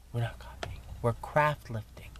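whispered playful aside; mock secretive whisper. Conspiratorial joke about copying.

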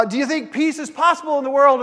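put-on high, loud rallying call to the kids; rallying question. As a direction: pump up the crowd. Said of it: "And I'd say to things like".

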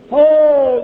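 a loud, high burst of praise; ecstatic cry. Real, not acted. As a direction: cry out in awe at the Lord. Preacher cries out in praise.

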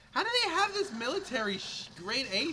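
high, incredulous outburst; can't believe it. Real, not acted. Outraged at game.

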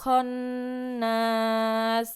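slow, drawn-out recitation of the verse; slow Quran recitation. Acted. Teacher recites the verse slowly for pupils to repeat. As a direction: chant it slowly for the class to follow.

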